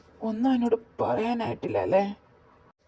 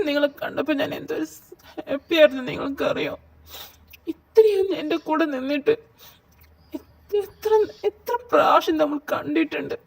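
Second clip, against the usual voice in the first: tearful reproach; 6 semitones higher than usual. Acted. Girlfriend crying reproach.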